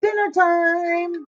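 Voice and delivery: high, very slow gloating call; wolf gloating about eating the pig; gleeful wolf. A narrator's reading. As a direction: savor every word like a feast. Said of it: "cried the wolf".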